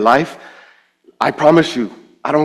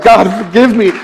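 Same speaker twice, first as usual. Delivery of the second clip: high, louder begging; retold tearful plea; 9 semitones higher, 6 dB louder than usual. Sinner on their knees before God, retold tearful plea. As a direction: cry out for mercy, re-enacted. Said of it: "I'm down on my knees and I'm bawling".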